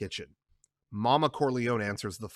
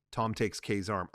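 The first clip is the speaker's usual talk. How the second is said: ordinary delivery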